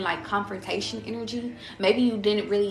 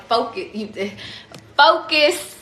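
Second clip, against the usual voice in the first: mock God barking focus, the second focus much higher and louder; playful divine order to focus; commanding God; 4 semitones higher, 8 dB louder, slower than usual. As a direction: break into a laugh mid-command, then shout focus. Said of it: "God is like".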